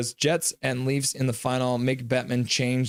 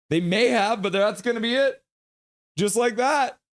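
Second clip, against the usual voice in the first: higher-pitched live commentary call; hockey play-by-play; 10 semitones higher than usual. Real, not acted.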